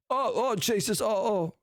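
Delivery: high-pitched panicked yelps from the brother-in-law; panicked high cries. Retold or imitated. Brother-in-law finding the rotten-smelling turkey, panicked high yelps. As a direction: squeal it out like a man in a panic.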